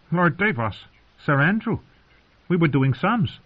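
put-on high-pitched boy greeting visitors; boy's voice. A narrator's reading. Boy answers intruders in story.

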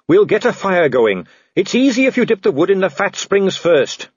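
put-on high, eager young-officer style helpfulness; Carrot, cheerful. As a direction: chatter on cheerily, voice lifted. Voices Carrot, the cheerful rescuer.

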